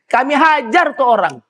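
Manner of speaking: high-pitched, declaimed threat; devils vowing revenge. Acted. Iblis's family promising to beat whoever upset him, declaimed high.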